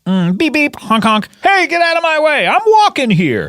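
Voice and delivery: hammed-up New Yorker shouting at a cab; silly clown. Imitating New York cab scene.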